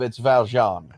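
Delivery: Val Jean as in Les Mis, French style; French pronunciation. Shown as a demonstration. Correcting a friend, says Val Jean in French. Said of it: "If it's from Les Miserables".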